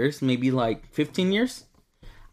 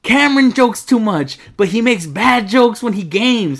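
loud, higher teasing jab; 7 semitones higher, 8 dB louder than usual. Real, not acted. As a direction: call him out loudly. Teasing argument, raised voice.

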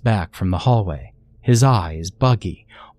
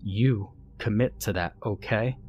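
softer, firm warning; stern; 9 dB quieter than usual. Acted. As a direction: press it home quietly but firmly. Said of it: "pointed one thick finger at me stern".

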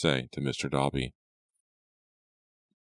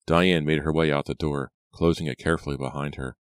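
usual speaking voice

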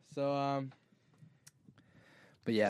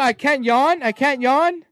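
indignant; 6 semitones higher, 8 dB louder than usual. Pretend. Indignant at mom.

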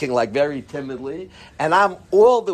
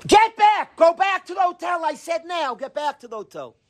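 angry shout; 13 semitones higher than usual. Retold or imitated. Rebbe yelling at students who refuse to leave, furious shout. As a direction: bellow them back to the hotel. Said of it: "my Rebbe was an all-out angry".

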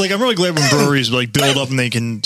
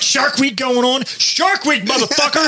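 excited; 10 semitones higher, slower than usual. Real, not acted. Hyping Shark Week.

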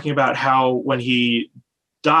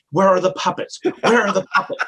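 mock demanding lady; 6 semitones higher than usual.

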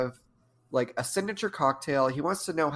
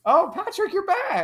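high, bright welcome-back squeal; excited greeting; 5 dB louder than usual. Real, not acted. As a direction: greet him with a big high gush. Greeting boyfriend, pitch way up.

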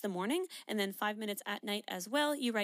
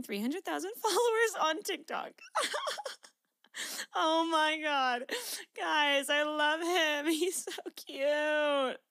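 real laughter breaking into teary, louder cries; laughing through tears; 7 semitones higher, 5 dB louder, slower than usual. Real, not acted. Reading her dad's proud text to listeners, laughing tearfully. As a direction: overcome with joy, laughing and half crying.

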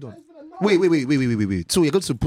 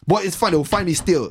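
loud sharp voice; 7 semitones higher than usual. Real, not acted. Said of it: "Why are you yelling?".

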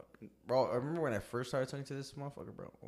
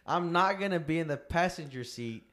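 heated and loud; 6 semitones higher, 11 dB louder than usual. Real, not acted. Heated rant about [name].

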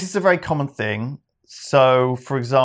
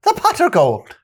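much higher mock Irish voice; high Irish accent; 5 dB louder than usual. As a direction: squeak it out in a high Irish lilt. Repeating shape name in accent.

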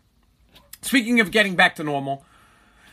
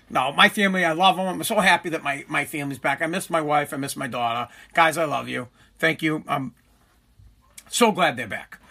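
mock sincere; 7 semitones lower, 3 dB quieter than usual. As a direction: lower and softer, put-on heartfelt tone. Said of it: "was that sincere enough?".

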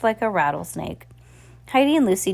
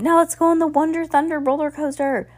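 excited; 9 semitones higher than usual. A narrator's reading. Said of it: "Lucy said".

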